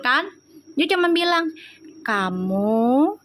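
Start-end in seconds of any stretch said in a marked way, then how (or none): 2.0-3.2 slow refined speech. Retold or imitated. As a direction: speak like a gentle Javanese princess. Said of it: "Pelan, suaranya nggak kenceng".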